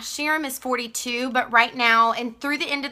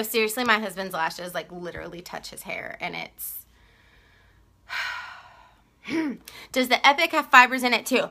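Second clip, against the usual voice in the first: normal talking voice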